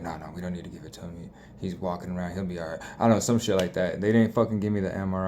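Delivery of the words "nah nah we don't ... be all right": much softer, fast, low offhand murmur; overheard staff. Retold or imitated. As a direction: mumble it quickly like overheard doctors. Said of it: "I heard them talking to each other like".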